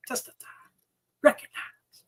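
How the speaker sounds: unvoiced whispered catchphrase. Real, not acted. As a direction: whisper it as a sign-off. Catchphrase after plug.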